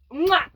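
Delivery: loud smacking kiss; playful kiss sound. Retold or imitated. Kissing the boy on the log, loud smack kiss. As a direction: plant a quick exaggerated kiss. She kissed him.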